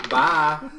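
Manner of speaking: loud teasing farewell; shout. Acted. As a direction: call it out mockingly. Players yell after fleeing kobold.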